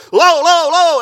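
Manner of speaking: loud mock yell of an angry rabbi; a loud imitated rabbi snapping no. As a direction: shout the refusal, retelling a rabbi's outburst. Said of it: "rabbi started screaming and yelling".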